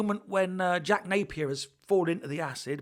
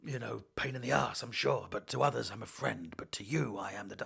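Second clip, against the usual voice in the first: low, hesitant Batman musing; low and quiet, like a wordy Batman; rambling Batman voice; 6 semitones lower, 4 dB quieter than usual. Retold or imitated. Parodying a long-winded Batman.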